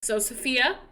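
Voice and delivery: usual speaking voice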